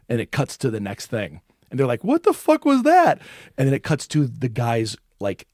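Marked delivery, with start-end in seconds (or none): 2.0-3.2 high, loud alarmed cry; startled yell. Acted. Boys startled by a creepy cut, shouting high. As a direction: jump and shout it.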